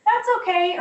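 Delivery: high, light reassuring voice; calm the kids down, lightly. Retold or imitated. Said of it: "I say".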